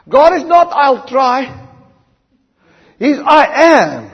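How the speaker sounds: loud, ringing pulpit delivery; rising sermon fervour; full-force preaching. Acted. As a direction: preach it out to the whole hall.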